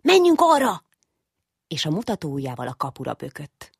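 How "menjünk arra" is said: put-on much higher, loud child's cry; eager cry. Little Ödönke cries out to his father at the gate, high and loud. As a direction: call out pointing at the gate. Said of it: "Ödönke felkiáltott".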